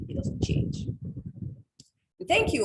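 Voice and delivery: ordinary delivery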